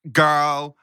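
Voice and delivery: high, put-on sassy call; mock sassy. Mocking queens round the morning table, high showy sass. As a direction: parody someone trying hard to be sassy. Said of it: "everyone's trying to be like".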